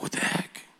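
startled hushed whisper. Retold or imitated. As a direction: breathe it out under your breath, startled.

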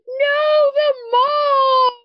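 high, slow, mock-tearful little girl; child crying. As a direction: wail it like a heartbroken kid. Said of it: "she's like".

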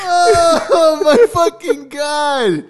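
very slow, high, near-tearful wail; overcome with laughter. Real, not acted. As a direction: drag it out, worn out from laughing. Listener overcome by the funny story.

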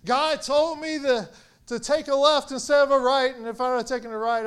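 high, loud mock testifier, speeding up; hammed-up testimony, stretched at first, then rushing; mock church testimony. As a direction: playfully imitate an excited churchgoer's testimony. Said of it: "you've probably heard it in church".

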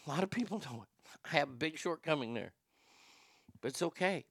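usual speaking voice

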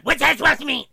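high, loud, snippy; venomous retort. Retold or imitated. Retelling the cousin's venomous snap, loud and high. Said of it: "the fucking pissiest, most venomous comment".

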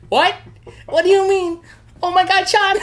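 very high, loud mock wail; hammed-up horrified mother cry. Mom hearing son is jailed, mock shrieking shock.

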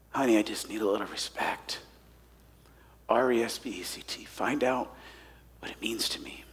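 soft, low and hushed, fading to a whisper; tender plea. Retold or imitated. [name] quietly asking his wife, soft and hushed. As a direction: ask your wife gently, almost under breath. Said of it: "to his wife".

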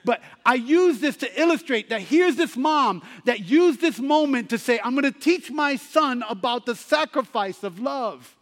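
full-force sermon climax over music; preaching at full force. Acted. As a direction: build the altar call with fervour. Preacher drives home the point.

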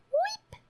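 tiny very high squeak; imitated cat cry. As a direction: mimic the cat's little peep. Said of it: "He comes in, he's like".

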